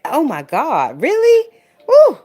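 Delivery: oh my God, really, then a loud high whoop; incredulous shriek. Real, not acted. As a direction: voice shoots up into a disbelieving shriek. Exasperated reaction to filthy house.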